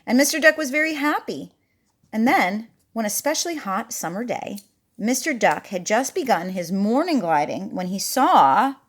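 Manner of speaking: ordinary delivery, nothing marked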